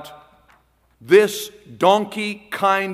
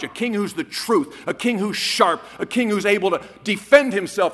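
ordinary talk

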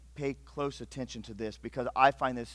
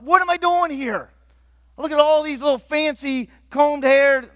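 loud, much higher, disbelieving; exasperated inner thought; 11 semitones higher, 10 dB louder than usual. Retold or imitated. Young man at Bible college, loud and disbelieving. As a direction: blurt out how out of place you feel.

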